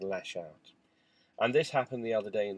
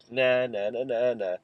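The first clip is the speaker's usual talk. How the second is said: teasing; 5 dB louder than usual. Retold or imitated. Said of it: "started teasing him".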